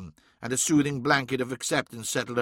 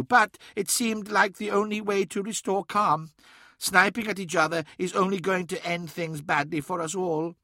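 Mahavastu; 6 semitones higher than usual. A narrator's reading. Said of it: "said Mahavastu".

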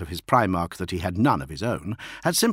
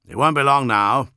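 higher, louder character voice; raised voice of expectation; portentous anticipation; 5 semitones higher, 6 dB louder than usual. A narrator's reading. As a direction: announce the coming fight. Solomon foretells coming battle.

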